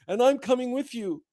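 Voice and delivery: bright high mock Sam, gently comic; eager Sam. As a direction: pitch it up as a devoted, determined Sam. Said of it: "And Sam says".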